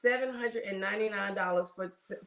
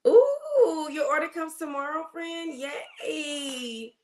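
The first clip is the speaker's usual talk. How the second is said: bursts out high and loud, then softens; thrilled squeal over a delivery; excited; 6 semitones higher, 3 dB louder, slower than usual. Real, not acted. Nurse on her live, thrilled her order comes tomorrow, excited squeal. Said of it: "I'ma try not to talk too loud".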